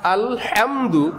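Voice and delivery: আলহামদু slowed right down to show the Arabic হা; slow demo of the Arabic হা in আলহামদু; Arabic ha sound. Shown as a demonstration. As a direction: stretch আলহামদু so the Arabic হা is heard. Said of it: "এটা হা".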